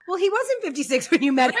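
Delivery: high, laughing comeback; laughing retort. Retold or imitated. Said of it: "And it's like".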